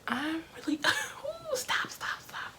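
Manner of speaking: whispered, laughing through tears, stop trailing off; real tears, fighting them with a small laugh; choked-up whisper. Real, not acted. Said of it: "I'm really getting emotional on camera".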